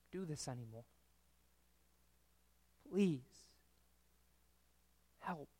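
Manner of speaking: soft, broken pleading prayer, trailing off; a lost child's whispered-quiet cry for help; hushed desperate plea. Acted. Child alone in bed pleading quietly with God. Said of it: "cries out to God".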